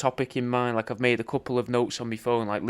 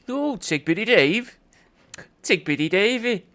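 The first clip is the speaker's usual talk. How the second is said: lilting sing-song; 8 semitones higher than usual. Shown as a demonstration. Showing how he matches the refrain, high and lilting. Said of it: "Or if I'd be like".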